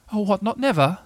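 lightly voiced small worried creature; Piglet. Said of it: "said Piglet".

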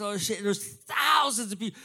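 ordinary delivery

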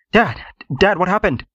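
high, urgent call to his dad; son's anxious, high-pitched question; alarmed son. A narrator's reading. Son runs to his crying father.

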